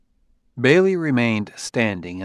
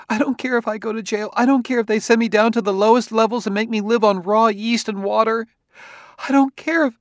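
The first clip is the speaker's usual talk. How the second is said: very high, desperate woman's outburst; tearful and frightened; 12 semitones higher, 3 dB louder than usual. A narrator's reading. Wife begging her husband to protect her, frightened. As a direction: build higher to a stammering, tearful plea. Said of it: "let her cry".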